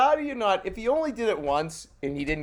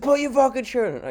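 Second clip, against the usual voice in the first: barked order, higher and louder; ranger yelling; 9 semitones higher, 4 dB louder than usual. Retold or imitated. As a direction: play the angry ranger snapping at him. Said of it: "He said".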